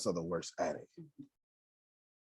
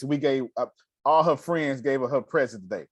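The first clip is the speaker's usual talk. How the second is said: usual speaking voice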